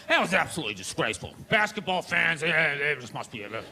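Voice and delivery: low, fast, pompous newsman voice; tut-tut like a scandalized anchor; stuffy announcer. Retold or imitated. ESPN anchor condemning the brawl, pompous. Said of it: "They were like".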